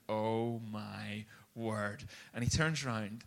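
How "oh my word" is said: very slow, hushed disbelief; stunned. Retold or imitated. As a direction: draw it out as if dumbstruck. Stunned onlookers after the tirade.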